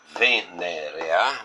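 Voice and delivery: venerear drawn out very slowly, syllable by syllable; teacher spelling out a word; syllables spelled out. Shown as a demonstration. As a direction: sound out ve-ne-re-ar like a teacher. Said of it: "se nós computarmos essa primeira possibilidade".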